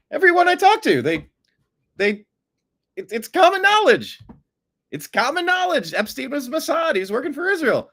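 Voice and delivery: high, loud incredulous impression; Tucker Carlson impression. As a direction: play an amazed pundit. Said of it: "Like Tucker said, he's like".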